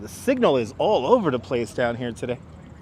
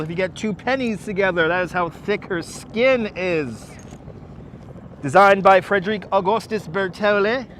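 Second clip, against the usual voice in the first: tour guide voice; 3 semitones higher than usual. Acted. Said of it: "Love the tour guide voice".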